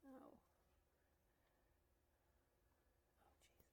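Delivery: real frustrated whisper to herself; barely audible, breathy grumble at the screen; whispered muttering. Real, not acted.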